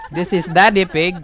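Peppa intro imitation. Player introducing creatures as the Peppa Pig family, cartoon narration. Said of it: "Peppa Pig family".

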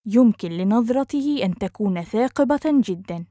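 quick, flowing and joined up; fast model sentence; natural speed. Shown as a demonstration. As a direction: run the phrase together fluently. Teacher repeats phrase at speed.

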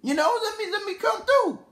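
high-pitched girl impression; imitated girl, pitch climbing very high at the end; woman's voice. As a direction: put on an eager high woman's voice. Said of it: "she's like".

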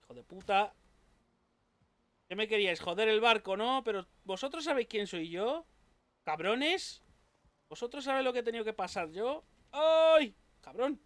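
loud furious yelling at enemies; angry taunting. Real, not acted. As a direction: shout insults mid-fight. Fighting enemies, taunting them.

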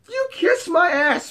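high-pitched indignant woman; mock angry insult. Offended woman telling man off, mock fury. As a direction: imitate her telling him off.

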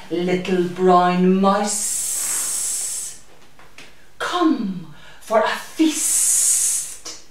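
slow unvoiced snaky hiss; hissing snake. Snake tempting the mouse to a feast, slow hiss. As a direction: draw it out like a snake.

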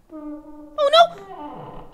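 sudden frightened cry; scared cry. Real, not acted. Door opens in horror game.

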